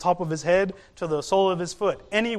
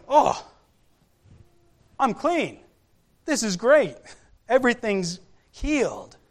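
higher-pitched acted-out joy; excited, amazed; 4 semitones higher than usual. Healed lepers standing there realizing they are clean, excited. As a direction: imitate someone marveling at being healed. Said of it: "excited about it".

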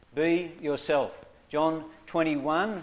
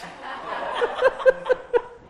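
very high breathy laugh; laughter; 4 dB louder than usual. Real, not acted. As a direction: giggle at the mischief. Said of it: "laughs at the plan".

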